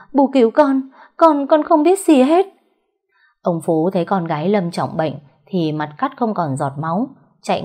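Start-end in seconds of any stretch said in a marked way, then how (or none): 0.1-2.5 very high, loud panicked begging; a frightened daughter begging her father to save her; shrill with terror, pleading for help; terrified plea. A narrator's reading. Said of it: "thất kinh không nói thành lời".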